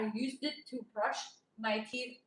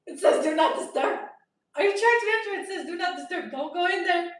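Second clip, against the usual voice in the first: loud, high, stern "do not disturb"; retold scolding; 6 semitones higher, 10 dB louder than usual. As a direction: warn him off the room, voice raised. Scolding cleaner at door.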